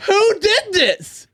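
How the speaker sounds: exaggerated high drawn-out outrage; mock outraged shriek. Mock outrage at app design.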